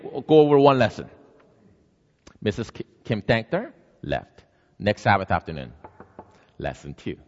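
ordinary talk, nothing marked

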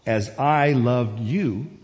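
slow, deliberate, weighted words; slow scripture reading. Real, not acted. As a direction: read it unhurriedly for reflection. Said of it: "I'm gonna read it slowly".

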